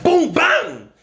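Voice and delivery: loud high mock punches; punch sound effects. Kid acting out his two-piece punch. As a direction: smack out the two hits.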